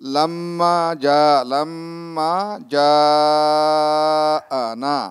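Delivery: "লাম্মা যা আনা" chanted with long-drawn vowels; slow recited "লাম্মা যা আনা", vowels held long; lengthened madd. Shown as a demonstration. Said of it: "টানটা আর একটু দীর্ঘ হলে".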